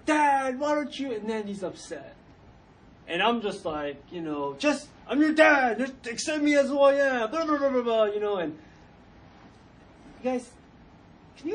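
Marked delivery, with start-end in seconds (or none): 0.1-1.1 put-on loud, high-pitched teen protest; exasperated complaint. Embarrassed son confronts dad, loud exasperated complaint. 4.6-8.0 loud, high, blustering self-defence; mock indignant dad. Dad defends himself to upset son, mock indignant rant.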